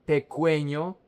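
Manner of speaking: "pequeño" said as peh-KWEH-nyo, ending up; mispronounced with W. Shown as a demonstration. Teacher shows how many mispronounce it, "pequeño" with KWEH. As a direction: put a kw in "pequeño", rising. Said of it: "And it's not".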